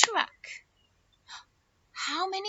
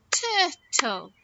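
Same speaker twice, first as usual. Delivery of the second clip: "Turtle" split into two even beats; "Turtle" slowly, as tur, tle; clapped-out syllables. Shown as a demonstration. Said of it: "Let's clap it out".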